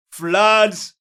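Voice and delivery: usual speaking voice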